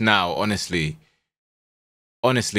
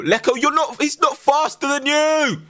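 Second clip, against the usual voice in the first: frustrated yell. Real, not acted. Yelling at player in game.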